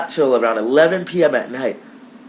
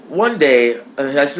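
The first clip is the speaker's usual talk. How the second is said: plain reading voice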